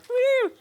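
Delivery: high, loud whoop; mock cheer. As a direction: whoop like a delighted female fan. Mimics ladies cheering the movie.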